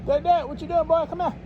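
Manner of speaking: high playful call to his dog across the lot. Real, not acted. Calling his dog under trailer.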